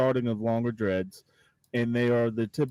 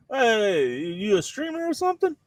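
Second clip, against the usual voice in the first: put-on thick Cajun accent; 10 semitones higher than usual. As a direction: greet the new roommate in a bouncy Cajun accent. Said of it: "thick Cajun".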